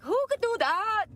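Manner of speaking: put-on Irish accent. Cat lady asking who abandoned the cat, in an Irish accent. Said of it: "I'm horrible at an English act or a Irish accent".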